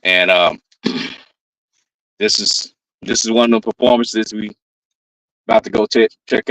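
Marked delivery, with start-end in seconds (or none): none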